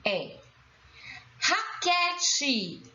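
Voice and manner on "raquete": "Raquete" stretched out, pitched high; drawn-out syllables. Shown as a demonstration. Teacher showing the stressed syllable, "Raquete" drawn out. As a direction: sound out "Raquete" slowly for learners. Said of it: "Qual é a sílaba tônica?".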